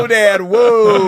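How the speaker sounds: slow, loud laughing whoa; mock shock at dad's joke. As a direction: stretch out a stunned whoa. Shocked dad joked with her.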